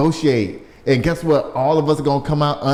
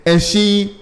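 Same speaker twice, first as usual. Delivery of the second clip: normal talking voice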